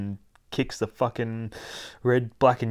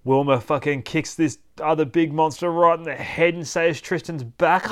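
ordinary delivery

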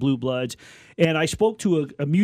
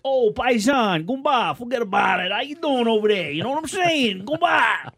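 loud, much higher Italian American New York voice, shouting the greetings; Italian American goomba; 8 semitones higher, 3 dB louder than usual. Retold or imitated. Host imitating Italian Americans greeting each other, loud hammed-up Italian American accent. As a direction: ham up a Jersey Italian American paisan calling out to his buddy. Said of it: "it's like".

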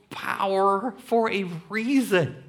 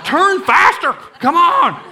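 high, loud impatient instructor yell; mock shout; 12 semitones higher, 10 dB louder than usual. Instructor yelling at the teen driver, loud and high. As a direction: bark at the learner to hurry.

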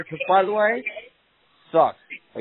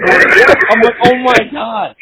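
higher, louder oh my God; retold panic; 7 semitones higher, 9 dB louder, slower than usual. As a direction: gasp out the shock. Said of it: "And I'm like".

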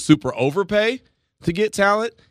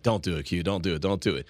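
hushed warning; 9 semitones lower, 6 dB quieter than usual. Retold or imitated. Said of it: "someone right now selling Kirby's is like".

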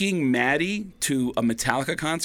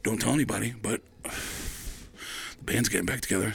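hushed secret; 5 semitones lower, 7 dB quieter than usual. Retold or imitated. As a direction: lower your voice for the news. Eddie shares band secret.

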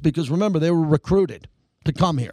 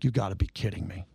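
much lower and softer, under the breath; muttered disbelief; 9 semitones lower, 9 dB quieter, faster than usual. Retold or imitated. Host reliving the NFL Network snub, muttering in disbelief. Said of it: "I'm like".

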